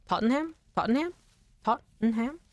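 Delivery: "Tottenham" repeated high with a puzzled rise; questioning tries. Shown as a demonstration. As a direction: keep testing "Tottenham" like a question. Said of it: "now I need to hear you say it".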